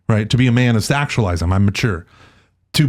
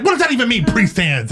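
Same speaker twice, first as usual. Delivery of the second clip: much higher, mock-exasperated priest; priest's retort; 10 semitones higher than usual. Host imitating the priest asking what priest hands means. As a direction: toss it back like an irritated priest. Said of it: "he's like".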